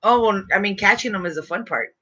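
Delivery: usual speaking voice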